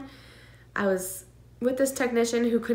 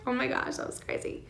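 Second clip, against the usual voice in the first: whispered disbelief; 4 semitones higher, 6 dB quieter than usual. Real, not acted. Recalling biggest scare.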